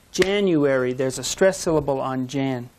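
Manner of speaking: ordinary talk, nothing marked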